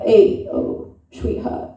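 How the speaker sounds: breathy whispered sleazy come-on; whispered Italian uncle. Retold or imitated. Uncle whispering to a woman, sleazy whispered come-on. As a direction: lean in and whisper like a leering uncle. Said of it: "they'd be like".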